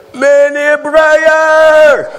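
drawn-out high call across the street. Retold or imitated. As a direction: hail him from a distance, slow and high. Students calling out on boulevard.

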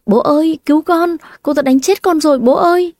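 high wailing cries to father, pleading; crying for help. A narrator's reading. Anna wails to her father after the slaps, high pleading cry. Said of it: "Anna gào khóc kêu cứu".

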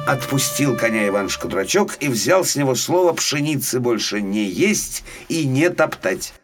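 ordinary delivery, nothing marked

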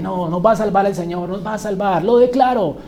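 remedo agudo que crece hasta la proclamación; imitando declaraciones positivas. Retold or imitated. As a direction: declara con confianza exagerada, en burla. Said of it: "Y declarando positivamente". (parodia de positivista insistente)

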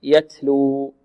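"يتلو" with a light puff of air after the ت; ت with flowing breath. Shown as a demonstration. Teacher gives the correct form, breath flowing on ت in "يتلو". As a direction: let the breath flow on ت in "يتلو", without turning it into س. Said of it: "بل همس مع جريان النفس".

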